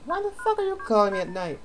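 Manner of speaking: high, put-on voice of the pissy girlfriend woken by the call. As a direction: ham up an annoyed phone call. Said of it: "be all pissy and be like".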